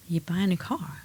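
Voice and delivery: softly voicing the calm husband, no fuss; a soft, unbothered take on the husband's answer. Husband calmly solving her car panic, soft and unbothered. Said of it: "And he was very calm".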